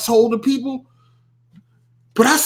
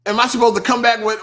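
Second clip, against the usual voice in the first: ordinary talk, nothing marked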